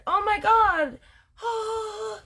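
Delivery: high, gushing oh my God; mock thrilled gasp; wife thrilled by her diagnosis, squealing high; excited squeal. Martha excited about diagnosis.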